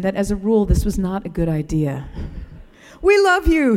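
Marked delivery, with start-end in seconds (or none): 3.0-3.8 raised, beaming call of affection, much higher and louder; Kareem's greeting. Retold or imitated. Attendant greeting the family's car, high bright call. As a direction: hail the family from the pumps, pitch well up. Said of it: "he'd say".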